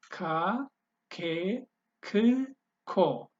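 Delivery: "k, ku, ki, ka" drilled one by one with a clicked K; click version of K. Shown as a demonstration. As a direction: flick the K sharply, pausing after each syllable. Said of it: "So the click or the flicking, um, version of k".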